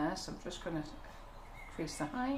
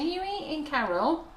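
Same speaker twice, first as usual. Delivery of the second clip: high, teasing nag; a hint of Claire nagging; imitating Claire; 7 semitones higher, 6 dB louder than usual. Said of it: "when Claire happens to mention".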